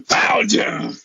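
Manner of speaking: loud, high anime shout. Acted. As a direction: burst out like an anime hero. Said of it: "the anime voice".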